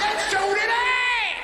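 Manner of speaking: high, loud, slow hammed-up rallying cry; mock Greg Wallace. Comic as Greg rallying to fix the family shopping. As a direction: shout it like a fired-up TV host. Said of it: "Greg's like".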